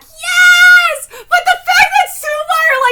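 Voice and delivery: high, loud excited shout; triumphant cheer. Real, not acted. Fan cheering after reading the reply, triumphant shout.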